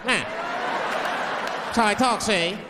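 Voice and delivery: hammed-up gangster retort; forties bad guy. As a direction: insist like a movie crook. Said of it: "that's how bad guys used to talk".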